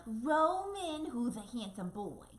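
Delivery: high, sing-song pet talk; animal voice. Shown as a demonstration. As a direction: coo at the goat like a baby. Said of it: "That's my animal voice".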